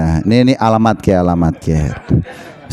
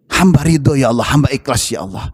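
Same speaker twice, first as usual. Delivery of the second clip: lowered, yielding prayer; pitiful prayer; 6 semitones lower than usual. Acted. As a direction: let the plea fade into resigned submission. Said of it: "Rasulullah melas".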